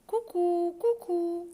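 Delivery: cri de coucou. Acted. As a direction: sing coucou like a cuckoo bird. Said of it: "joue la clarinette". (imitation aiguë du coucou)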